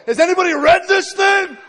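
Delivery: very high, full-volume yell of amazement; excited shouting. Retold or imitated. New reader yelling from his front lawn, excited shout. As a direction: holler it across the front lawn. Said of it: "run out in my front lawn and go".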